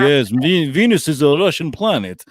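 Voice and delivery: hammed-up Russian accent, with a big laugh after. Podcast host quoting a Russian official, hammed-up Russian accent. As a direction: put on a jokey Russian accent. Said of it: "Lucy loves my Russian accent".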